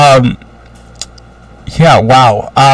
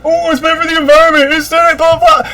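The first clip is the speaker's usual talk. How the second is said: mocking Europeans; 9 semitones higher than usual. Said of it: "Why Europe hates air conditioning".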